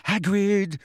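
high-pitched, frightened call; terrified. A narrator's reading. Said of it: "high-pitched and terrified voice".

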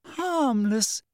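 high, incredulous squeak; shrill it up in disbelief. A narrator's reading. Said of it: "said Hermione shrilly".

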